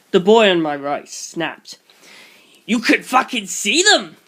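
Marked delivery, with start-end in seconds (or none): 2.7-4.2 loud, higher angry roar. A narrator's reading. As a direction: roar it at him, furious. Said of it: "he roared".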